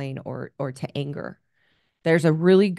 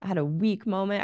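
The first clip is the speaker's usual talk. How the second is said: ordinary delivery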